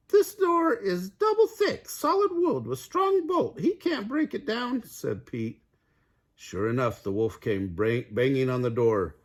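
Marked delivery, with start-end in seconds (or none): 0.1-4.8 very high piggy voice; calm little pig. A narrator's reading. Pete reassuring his brothers in a high piggy voice. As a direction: reassure them in a squeaky pig voice.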